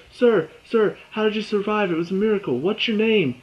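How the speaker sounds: high, pushy reporter voice calling out; eager reporter. Retold or imitated. To Luke in the rubble, excited high reporter calls. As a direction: play a reporter shouting questions at the survivor.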